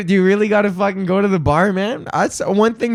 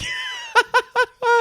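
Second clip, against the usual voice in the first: high shrieking laugh; 3 dB quieter than usual. Real, not acted. Host cracking up over the pub story, high shrieking laugh. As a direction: burst of high squealing laughter.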